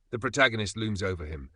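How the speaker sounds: ordinary delivery, nothing marked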